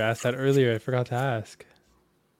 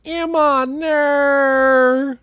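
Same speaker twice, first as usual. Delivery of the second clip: high, loud, very drawn-out Aussie wail; mock Australian accent; 12 semitones higher, 5 dB louder, much slower than usual. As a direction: imitate an Australian girl's stretched cry. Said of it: "this clip where it was like".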